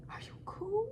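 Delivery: soft breathy whisper with a rising end; whispered aside. Retold or imitated. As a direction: whisper the question as if to yourself. Aside mid story, whispered.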